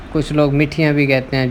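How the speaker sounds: ordinary delivery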